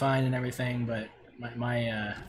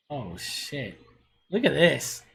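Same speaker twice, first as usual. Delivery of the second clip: usual speaking voice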